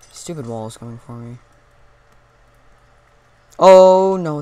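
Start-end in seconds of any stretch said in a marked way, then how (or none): 3.6-4.4 loud startled oh no; alarmed shout. Real, not acted. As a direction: blurt it out in alarm. Sudden alarm.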